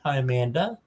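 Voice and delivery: ordinary talk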